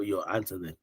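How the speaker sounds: usual speaking voice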